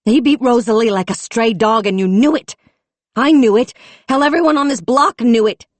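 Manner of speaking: high loud accusing tirade; furious tirade. A narrator's reading. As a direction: blame them all at full voice. Raging at the chief.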